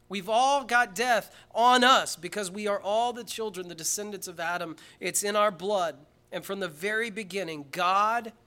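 loud, high, ringing preacher's cry; rising sermon fervor; preaching at full force. Acted. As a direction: drive it home to the congregation.

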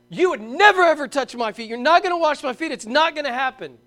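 loud, high indignant refusal; insistent refusal. Retold or imitated. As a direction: refuse him flat out. Peter refusing Jesus loudly.

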